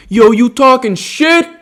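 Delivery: imitating a guy calling out a challenge; blurted challenge. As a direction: imitate him picking a fight down the hall. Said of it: "Harry blurts out".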